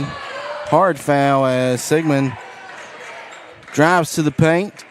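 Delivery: play-by-play. Acted. Announcer calling the late fourth quarter, livelier play-by-play. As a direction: ride the action up.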